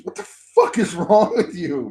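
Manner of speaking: high-pitched exasperated demand; retold outrage. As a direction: scold in comic disbelief. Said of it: "That's what I said".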